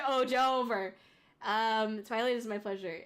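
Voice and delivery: normal talking voice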